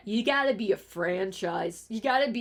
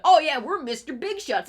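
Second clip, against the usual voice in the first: mock big shot; 5 semitones higher, 5 dB louder than usual. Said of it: "normies trying to be like".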